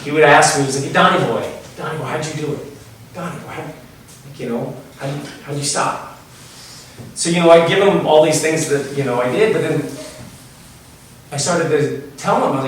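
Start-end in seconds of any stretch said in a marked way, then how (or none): 0.9-6.2 a hint of a drunk buddy pleading; soft drunken plea. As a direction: mumble it, half-whispered, like a drunk asking for help. Drunk friend asking how he quit.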